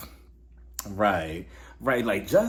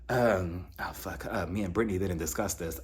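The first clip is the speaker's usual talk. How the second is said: low, soft imitation of an awkward man; caught-out mumble; 8 semitones lower, 7 dB quieter than usual. Boyfriend questioned about the relationship, low awkward mumble. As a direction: mutter it under your breath, stalling.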